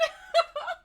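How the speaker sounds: high, loud burst of laughter; high laughing squeal. Real, not acted. Streamer cracks up at the changed text, squealing laugh.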